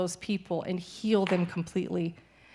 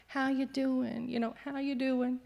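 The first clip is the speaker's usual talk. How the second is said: mock pitying concern; 5 semitones higher, 5 dB quieter than usual. People asking the sick missionary, mock pitying softness. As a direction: imitate everyone's soft worried check-in. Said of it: "like everyone else is".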